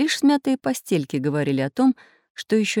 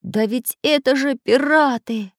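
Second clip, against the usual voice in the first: higher, drawn-out cry of horror; horrified cry; 6 semitones higher, slower than usual. A narrator's reading. Craftsman recognises the pirates, crying out in horror. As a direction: gasp it out slowly in mild horror. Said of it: "закричал, ужасаясь".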